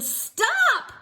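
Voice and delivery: put-on high shrill child scream; girl yelling as she is lifted; loud yell. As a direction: shout stop at full voice. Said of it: "yelled as loud as she could".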